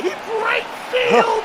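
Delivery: high, excited home run call; soaring play-by-play call; panting shaky eyewitness. Real, not acted. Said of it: "Excellent call there".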